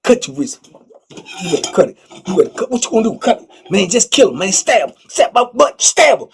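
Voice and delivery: rising, pressing commands; menacing orders. Retold or imitated. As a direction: push him to do it. Inmates ordering victim.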